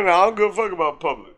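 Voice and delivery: usual speaking voice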